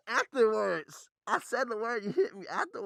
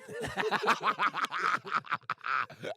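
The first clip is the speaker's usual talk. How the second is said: long burst of laughter; helpless laughing fit; 3 semitones lower than usual. Real, not acted. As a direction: crack up after your own line. Cracks up at own take.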